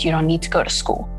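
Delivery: ordinary delivery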